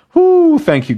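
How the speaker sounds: high, loud woo of thanks; joyful whoop. Real, not acted. Pastor thanking God with a high joyful woo. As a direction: cheer your thanks upward.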